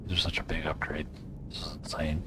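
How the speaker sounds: hushed, breathy amazement; awed whisper. Real, not acted. Gamer whispering in awe at new gear. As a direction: whisper it like you can't believe your luck.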